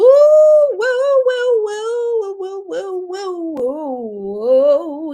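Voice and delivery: high rising whoas, loud then fading; excited. Real, not acted. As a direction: go way up on each whoa, then settle. Excited reaction to card.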